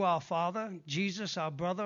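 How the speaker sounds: ordinary talk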